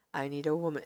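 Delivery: smug prince, softly teasing. Acted. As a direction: say it low and suggestive. Alexander line.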